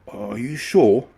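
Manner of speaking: slow, doubtful question; a little worried. A narrator's reading. Bill worried about the heavy carriages. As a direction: ask it slowly, worried. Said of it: "a little worried".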